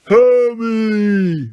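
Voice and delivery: loud drawn-out giant roar. A narrator's reading. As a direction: roar the name out. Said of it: "roared Grawp".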